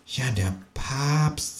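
soft, slow and hushed; quiet. A narrator's reading. Kangaroo answering the ghost quietly and slowly. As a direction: murmur it gravely. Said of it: "sagt das Känguru leise".

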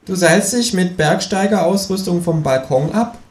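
high, slow cheeky kangaroo. Acted. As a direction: ask it like the sly kangaroo. The kangaroo's voice in the story. (hohe, langsame Känguru-Stimme)

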